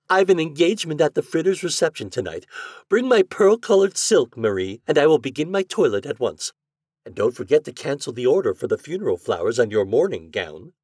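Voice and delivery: high, loud bossy lady; spoiled heiress. Acted. Heiress ordering maid Marie, high and bossy.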